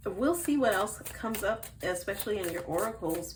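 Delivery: usual speaking voice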